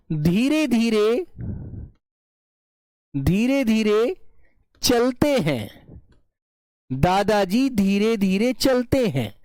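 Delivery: slow, spaced dictation pace; teacher drawing out an example sentence; slow, drawn out. Shown as a demonstration. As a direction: stretch each word out for the class. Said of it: "चलते कैसे हैं?".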